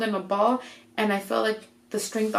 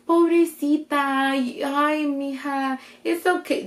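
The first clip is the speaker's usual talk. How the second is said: mock high-pitched cooing sympathy; hammed-up, high pitying coo; babying; 6 semitones higher, 3 dB louder, slower than usual. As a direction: imitate a mom fussing over her poor girl. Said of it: "Don't baby me".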